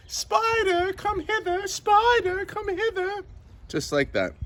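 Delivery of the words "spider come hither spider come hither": very high, sing-out calling; mock shouting. Slaves calling spiders all over the place, mock shouting. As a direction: call out like slaves hunting all over the house. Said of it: "they were shouting all over the place".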